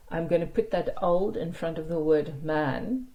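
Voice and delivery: normal talking voice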